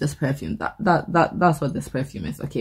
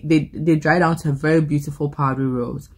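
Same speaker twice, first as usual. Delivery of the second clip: ordinary talk, nothing marked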